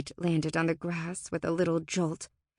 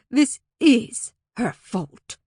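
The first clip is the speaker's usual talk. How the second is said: slow, loud, emphatic blame; glaring accusation; 4 semitones higher, 7 dB louder, much slower than usual. A narrator's reading. Fae turns back to Alora with a glare, accusing. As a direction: spell out the blame word by word. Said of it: "a glare".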